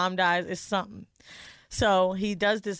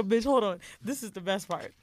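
usual speaking voice

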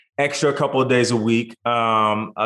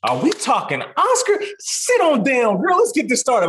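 excited greeting; 10 semitones higher than usual. Retold or imitated. Retold woman greeting him excitedly.

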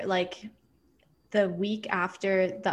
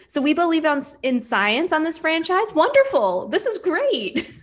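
high, loud mock amazement; exaggerated delight, stretching out the last words; hammed-up delight; 7 semitones higher, 4 dB louder than usual. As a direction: gush it, higher and louder. Acts out her delighted reaction.

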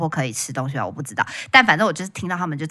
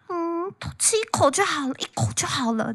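put-on much higher, half-crying plea of an old woman; whining old lady; 10 semitones higher, 7 dB quieter than usual. An old wife begging her husband for one bite, whining. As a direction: beg for one bite like a spoiled granny.

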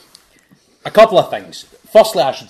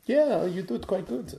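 imitating the Dutch player; 8 semitones higher, 3 dB quieter than usual. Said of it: "he went".

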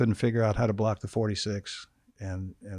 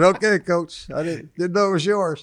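higher, louder mock-innocent protest; retold apology; 8 semitones higher, 6 dB louder than usual. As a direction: play innocent to the coach. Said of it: "I said".

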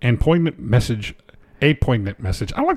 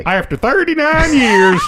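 bad acting; 10 semitones higher than usual. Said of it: "I'll deliver it like you would".

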